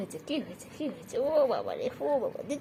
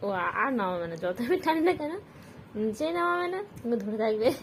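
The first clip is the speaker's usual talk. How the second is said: normal talking voice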